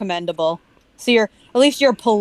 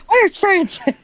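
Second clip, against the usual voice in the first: high far-off shouted question; hammed-up movie shout; high mock shout; 11 semitones higher than usual. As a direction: shout it like a scared movie victim. Quoting a movie line.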